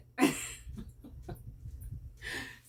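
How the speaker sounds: airy unvoiced laugh; breathy chuckle at her own trick; long laugh. Real, not acted. Laughing at the square chicken story.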